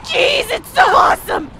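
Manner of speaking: high, overdone gushing; mock amazement; sarcastic awe. Real, not acted. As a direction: fake delight through clenched frustration. Sarcastic gushing over enemy armor.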